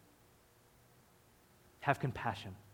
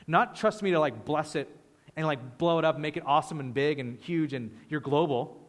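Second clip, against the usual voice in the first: ordinary talk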